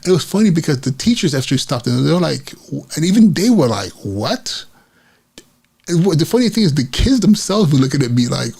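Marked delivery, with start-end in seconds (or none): 4.0-4.7 mock-stunned, rising question; imitated disbelief. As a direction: echo the teachers' bafflement, ending on a rise. Teachers' stunned reaction, imitated.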